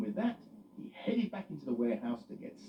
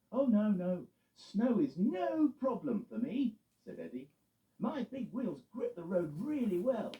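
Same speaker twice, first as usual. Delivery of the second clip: Eddie reassuring; 9 semitones higher than usual. Acted. Eddie voiced.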